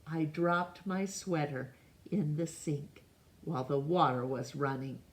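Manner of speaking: ordinary delivery, nothing marked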